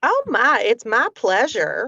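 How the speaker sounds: drawled sweet southern pleasure; slow, syrupy country drawl; country accent. Pretend. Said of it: "Well, thank me for something".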